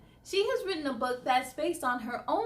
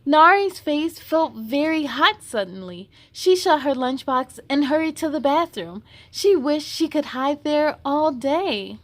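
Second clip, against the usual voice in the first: lively, louder storybook voice; reading to children; 2 semitones higher, 9 dB louder than usual. Acted. Host reading a picture book aloud to kids, lively. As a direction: read it brightly for young listeners.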